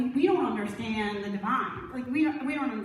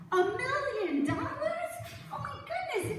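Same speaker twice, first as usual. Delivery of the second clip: acted astonishment; 12 semitones higher than usual. Old friend hearing of a million-dollar inheritance, hammed-up astonishment. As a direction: gasp at the sum, pitched way up.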